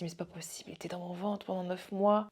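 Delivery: soft hushed whisper of wonder; awed whisper. Retold or imitated. Mother seeing her newborn for the first time, hushed whisper. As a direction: breathe it in awe. Awe at first sight of baby.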